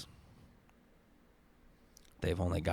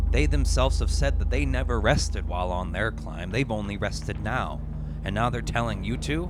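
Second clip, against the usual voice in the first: louder, indignant rising rant; angry; 2 semitones higher, 7 dB louder than usual. A narrator's reading. Climber railing against the well-rested, angry and louder. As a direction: let the frustration boil over. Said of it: "And it all makes you angry".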